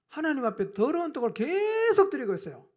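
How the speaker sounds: ordinary talk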